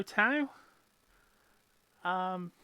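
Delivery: ordinary talk, nothing marked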